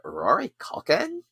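put-on Valley Girl "Rory Culkin?" with a rising question lilt. Shown as a demonstration. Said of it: "you gotta say it like a Valley Girl".

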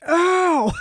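sharp high yelp of pain; high pained yelp, retold; scream of pain. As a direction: yelp "Ow!" as the shoulder goes. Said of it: "I screamed in the gym".

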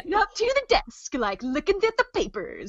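high, playful sneaking narration; Kronk. Acted. Player sneaking to the desk, Kronk style. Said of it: "but, like, Kronk style".

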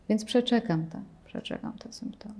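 trailing into a whisper; quiet patience. Pretend. Teacher shows waiting out symptoms, whispered patience. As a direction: whisper it calmly to yourself.

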